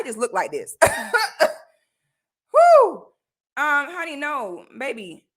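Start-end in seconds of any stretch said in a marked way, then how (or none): 2.5-3.1 high burst of laughter; laughing. Real, not acted. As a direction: laugh then blow out a whew. Laughs at own rant.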